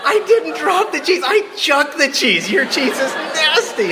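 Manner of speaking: high, loud, playful indignant voice; hammed-up outraged squeal; mock indignant retort. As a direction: toss it back like an offended kid. Retorting to boy in funny story.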